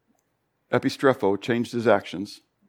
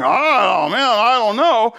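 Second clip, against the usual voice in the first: a hint of a stumped disciple, higher and slower; puzzled Philip; 8 semitones higher, 4 dB louder, much slower than usual. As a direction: play Philip scratching his head, lightly voiced. Preacher voices puzzled Philip.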